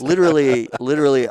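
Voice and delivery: high, loud excited emphasis. Real, not acted. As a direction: burst out eagerly. Excited repeat.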